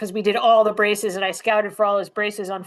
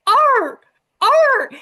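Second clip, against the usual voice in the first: high loud yelping bark impression; imitated dog bark; 13 semitones higher, 8 dB louder than usual. S1 imitates the crated lab's frantic bark. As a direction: bark like the scared lab in the truck.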